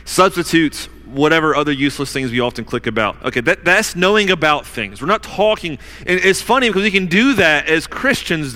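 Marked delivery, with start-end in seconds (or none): none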